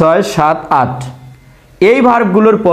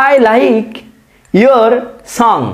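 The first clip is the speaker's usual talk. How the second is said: slow example; 4 semitones higher, much slower than usual. Shown as a demonstration. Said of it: "example sentence read out".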